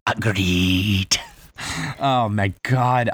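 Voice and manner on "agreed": pirate film quote. Acted. Playful pirate quote exchange.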